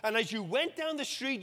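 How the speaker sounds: ordinary talk, nothing marked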